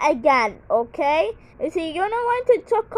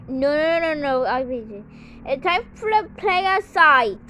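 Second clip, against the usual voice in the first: ordinary delivery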